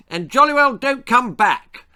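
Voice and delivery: loud high shout sending him off. A narrator's reading. Sam shouts at Dick as he sneaks away, loud. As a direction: yell it after the fleeing bully. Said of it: "shouted Sam".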